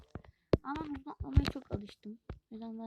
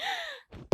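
sharp gasp. Real, not acted. Falls again in game.

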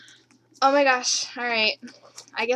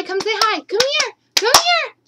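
building from a high call to a higher, louder one; calling the dog; 10 semitones higher, 7 dB louder than usual. Real, not acted. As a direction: call the puppy, getting more eager each time. Calling the dog, growing more insistent.

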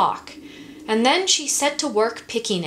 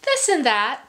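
breezy and evasive; 10 semitones higher, 3 dB louder than usual. Acted. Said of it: "said Louise".

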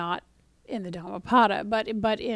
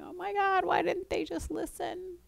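higher, exasperated mock complaint; a mock-frustrated reader, pitched up and pleading; 8 semitones higher than usual. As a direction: throw up your hands at the stubborn Israelites. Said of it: "the reader may become very frustrated, going".

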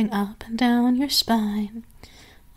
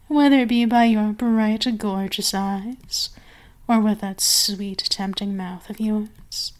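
sultry mistress. Acted. Mistress to her purring pet, sultry and teasing.

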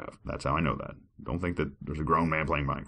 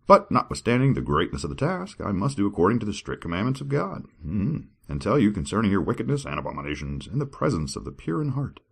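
grand, high dramatic reading; scripture reading; 4 semitones higher than usual. Acted. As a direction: declaim it like a preacher.

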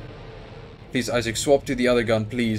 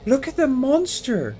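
very high, slow wail of horror; horrified wail; 12 semitones higher, slower than usual. Real, not acted. Player gawping at a monster, horrified wail.

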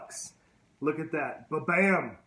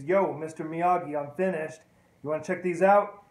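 loud call through the house; 6 dB louder than usual. Retold or imitated. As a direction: shout for him from the doorway. Daniel calls through the house.

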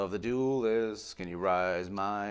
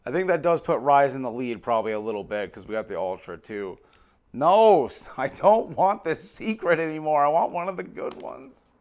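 louder, higher exasperated complaint; real frustrated outburst; 7 semitones higher, 10 dB louder than usual. Real, not acted. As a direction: vent at the pack, loud. Frustrated by bad pull.

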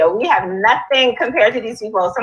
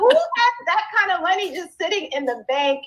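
higher, softer incredulous question; amazed disbelief; 9 semitones higher, 5 dB quieter than usual. Retold or imitated. Hearing a cash house offer, high soft disbelief. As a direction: wonder aloud who could be that rich. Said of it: "I'm, I'm just like".

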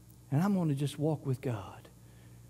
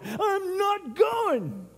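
a long, sulky, high-pitched refusal; drawn-out whine; 13 semitones higher, much slower than usual. Retold or imitated. Churchgoer refusing to come to church, slow and whiny. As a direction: stretch it out very slowly, pitched high.

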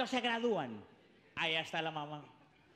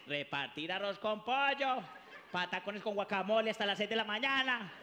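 ordinary talk

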